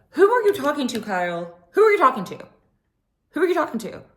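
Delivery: high, loud clapback; a loud, high repeated challenge; sassy shout. Acted. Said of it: "repeated calling out".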